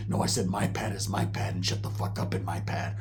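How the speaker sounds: very low, hushed threat; menacing dealer. Retold or imitated. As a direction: put him in his place, low and under the breath. Dealer quietly threatening Roach.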